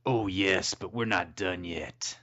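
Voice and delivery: bad cop. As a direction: answer low and measured as bad cop. Reading bad cop's part.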